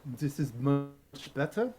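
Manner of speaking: language coach's put-on German accent. Coach obliges his student's request, German accent. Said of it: "the German one".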